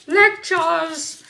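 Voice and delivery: high, loud put-on cry; mock exclamation. Reader sending up own lecturing, high mock exclamation. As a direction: burst out in a playful high voice.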